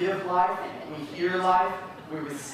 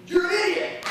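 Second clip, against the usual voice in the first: much higher, loud furious yell; angry yell; 6 dB louder than usual. Retold or imitated. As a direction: bark it as a rude outburst. Mock rude reply to gossip.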